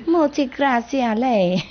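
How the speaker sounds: musical, lilting Italian thanks; sweet Italian. Retold or imitated. Master imitating sweet Italian women saying thanks. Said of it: "Sweet, sweet".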